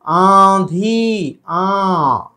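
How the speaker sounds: आंधी with a nasalised long aa, no n; very slow आंधी, first vowel through the nose. Shown as a demonstration. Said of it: "नाक से".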